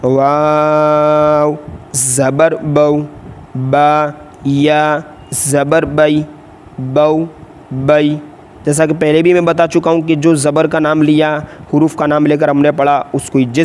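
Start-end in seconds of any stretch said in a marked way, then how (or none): 0.0-8.2 slow, drawn-out letter chant; sing-song classroom spelling; Qaida spelling drill. Shown as a demonstration. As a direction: chant each letter slowly for students to repeat.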